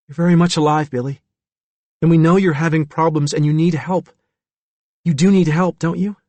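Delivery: gentle authority in a character voice; firm, kindly doctor. A narrator's reading. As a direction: steadily coax a frightened patient. Wilbur reassures Billy.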